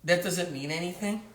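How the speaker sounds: usual speaking voice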